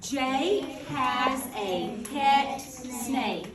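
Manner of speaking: teacher modelling the title slowly; slow choral read. Shown as a demonstration. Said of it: "Let's read that title together".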